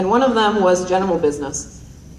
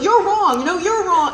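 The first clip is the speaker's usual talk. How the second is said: high, insistent, through a laugh; mock bickering teacher; 11 semitones higher than usual. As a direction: parody a petty squabble. Mocking teachers' pointless argument.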